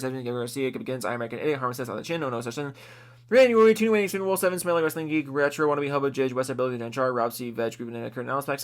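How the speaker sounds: ordinary talk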